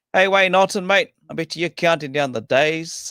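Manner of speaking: host hailing a viewer in the chat, loud and cheerful; loud shout-out. Real, not acted. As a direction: call out to the viewer like across a racetrack.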